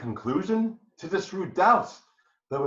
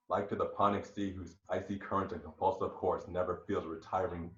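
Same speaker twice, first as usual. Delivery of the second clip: lower, much softer and menacing; cold, quiet vow; 6 semitones lower, 6 dB quieter than usual. Acted. Husband swears revenge to his ensign, much softer and lower. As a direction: drop to a deadly quiet resolve.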